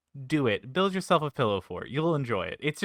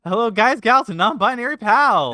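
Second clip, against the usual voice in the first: higher, louder sing-song hello; loud cheery greeting; 7 semitones higher, 6 dB louder than usual. Pretend. [name] gives the wrong greeting, loud and high. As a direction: greet everyone big and bright. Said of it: "That's the wrong saying".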